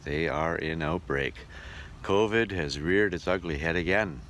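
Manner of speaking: usual speaking voice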